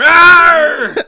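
loud, high mock roar; hammed-up furious "arr", drawn out; angry roar. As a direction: roar like an offended local grabbing a weapon. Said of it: "picking up their hurl and going".

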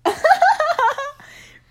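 squealing laugh; high laugh. Real, not acted. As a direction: burst out in a high giggle. Laughs at the tease.

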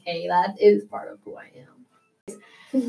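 slow whispered realization; inner realization whisper. Retold or imitated. Whispering what she finally understood about herself. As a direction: breathe it out slowly, almost unvoiced. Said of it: "old enough to realize".

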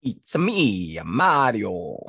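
a hint of Mario, too deep. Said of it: "That was way too deep".

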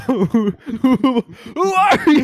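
very high, loud confused cry through laughter. Pretend. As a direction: play hammed-up confusion, laughing.

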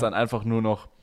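ordinary talk, nothing marked

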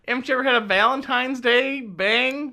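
high, sing-song dreamy voice; mock romantic. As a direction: play it like a swooning romantic.

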